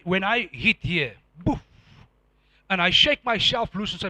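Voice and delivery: ordinary talk, nothing marked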